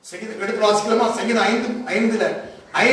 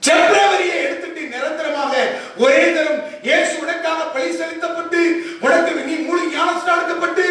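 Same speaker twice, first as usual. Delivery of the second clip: fervent preaching; 7 semitones higher than usual. Acted. Preacher urging the congregation about God's longing, fervent preaching. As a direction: press each phrase on the congregation.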